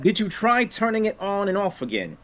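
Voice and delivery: a hint of nerdy Moss; Moss impression. Quoting Moss from the IT Crowd, lightly voiced and laughing. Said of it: "Moss from I T Crowd".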